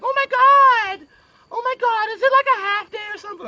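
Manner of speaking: high, loud, gushing mom voice; gushing happy mom. Retold or imitated. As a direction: squeal it like an overjoyed mom. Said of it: "she's all happy".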